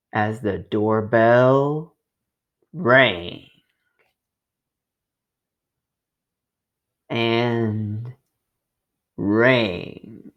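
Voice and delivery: slow, drawn-out doorbell build; storyteller slowing for the doorbell; suspenseful slowing. A narrator's reading. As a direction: stretch it out for suspense. Doorbell keeps ringing, suspense.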